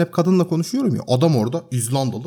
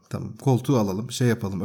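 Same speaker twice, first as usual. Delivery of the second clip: meek placating; 6 semitones lower, 6 dB quieter than usual. Retold or imitated. Placating the furious landlord.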